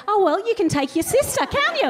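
high, sing-song mock mum. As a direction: put on mum's breezy fob-off voice. Mum voiced in a story.

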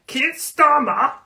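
"Starmer" drawn out high, slow and loud; hammed-up outrage at Keir Starmer; stress on STARMER. Mocking Keir Starmer to her viewers, high and drawn out.

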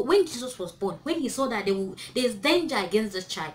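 ordinary talk, nothing marked

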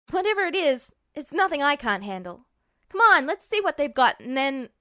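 loud, confident brag; cocky bravado. A narrator's reading. Edward brushes off his brother's worry, loud and cocky. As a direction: puff up and boast. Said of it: "he smirked".

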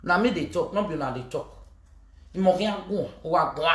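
ordinary talk

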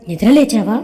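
ordinary delivery

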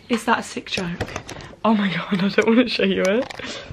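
ordinary delivery

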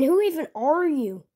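normal talking voice

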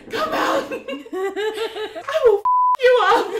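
high, loud laughing yell; mock threat yell. Girl with a knife yelling at a hidden intruder, loud high mock threat. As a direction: scream it at the empty hallway, half laughing.

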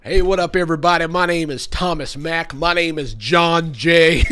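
loud mock party intro; mock country bro intro. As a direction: announce yourself like a brash country singer. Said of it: "these names".

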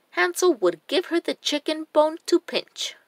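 plain reading voice

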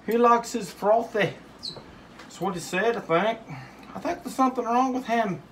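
a squeaky sidekick teasing; silly high voice. Acted. A silly second character teases him.

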